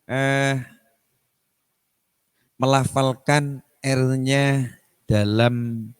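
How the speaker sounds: ordinary talk, nothing marked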